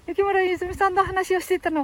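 high-pitched puppet voice; little high character voice; puppet sidekick cutting in. Pretend. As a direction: cut in as a small, high dummy. Puppet cuts in.

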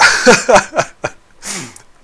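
loud laugh after yawning. Real, not acted. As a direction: laugh off a yawn. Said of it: "あくびが出ます。".

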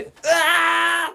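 long, high mock scream as the glider sinks; drawn-out hammed-up wail. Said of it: "って言って沈んでくるでしょ".

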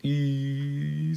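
long high-pitched wail; drawn-out acted crying; child's wail. A narrator's reading. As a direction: wail like a child starting to cry. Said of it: "ねねこちゃんは泣き出しました".

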